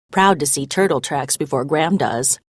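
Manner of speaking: ordinary delivery, nothing marked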